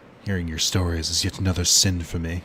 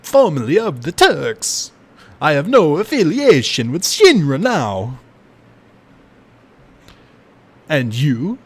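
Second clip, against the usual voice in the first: louder, higher, slow brooding Vincent read; 7 semitones higher, 7 dB louder, slower than usual. Acted. Streamer voicing Vincent from his coffin, louder brooding delivery. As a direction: intone it darkly like a cursed gunman. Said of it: "the accent seems spot on".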